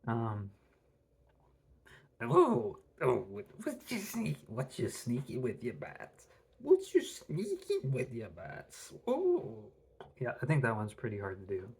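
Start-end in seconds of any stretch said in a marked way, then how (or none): 2.2-9.6 slow, theatrical cartoon villain voice; a comic-book Joker voice; Mark Hamill's Joker. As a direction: sneer it like a gleeful cartoon villain. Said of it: "Mark Hamill's Joker".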